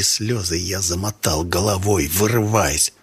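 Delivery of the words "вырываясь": ordinary delivery, nothing marked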